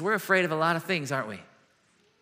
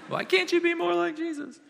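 high, put-on scolding mom voice; mock exasperated parent comparing siblings; nagging parent; 12 semitones higher than usual. Parent scolding Jesus' brother, mock exasperated nag.

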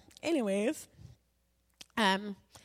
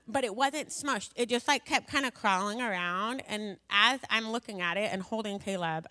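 usual speaking voice

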